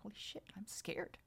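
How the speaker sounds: hushed retold whisper of fear; scared whisper. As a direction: whisper it as a private admission. Retells admitting fear to herself.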